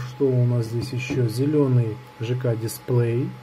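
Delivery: usual speaking voice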